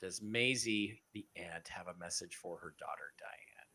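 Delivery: softly, dropping toward a whisper; hushed gentle question. Real, not acted. Host asks gently about a grieving caller's aunt, hushed.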